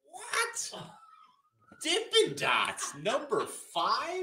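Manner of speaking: high loud outraged what; stunned, drawn-out protest; shocked disbelief. Real, not acted. Disbelief at Dippin' Dots pick.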